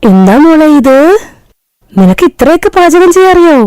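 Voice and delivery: mother-in-law stunned by the bride's menu; shocked mother-in-law. Acted. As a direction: gasp at the long menu. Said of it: "അമ്മായി ഞെട്ടി".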